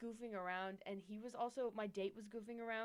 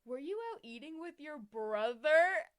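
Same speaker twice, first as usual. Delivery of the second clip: quoting crush; 6 semitones higher, 14 dB louder than usual. Retold or imitated. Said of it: "and he goes".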